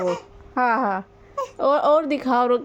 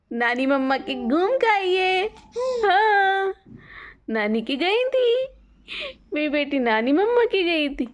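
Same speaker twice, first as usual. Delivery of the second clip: usual speaking voice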